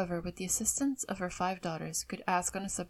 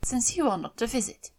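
louder, higher, mildly resentful; a resentful jab from mother; 4 semitones higher, 5 dB louder than usual. A narrator's reading. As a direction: push it out with mild resentment. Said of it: "said her mother resentfully".

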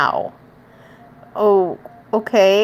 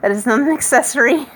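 ordinary delivery

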